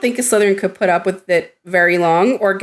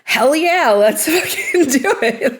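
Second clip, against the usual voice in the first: mock battle cry; 5 semitones higher than usual. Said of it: "He's screaming".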